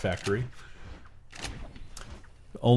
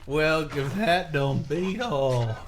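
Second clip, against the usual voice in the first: slow cowboy with a whistle; Slim Pickens; 4 semitones higher, much slower than usual. Pretend. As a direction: whistle the s sounds, slow and high. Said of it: "I'm trying to whistle when I say it".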